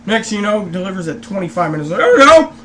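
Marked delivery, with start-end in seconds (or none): 2.0-2.5 mock waiter. Waiter delivering wrong sauce, retold.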